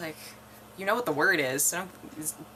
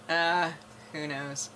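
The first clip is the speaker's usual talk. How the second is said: ordinary delivery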